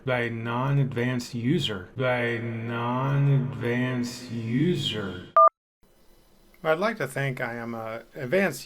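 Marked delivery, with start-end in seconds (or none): 1.9-5.3 drawn-out reading. Shown as a demonstration. Host teasing the asset maker's name, drawing "By non-advanced user" out very slowly.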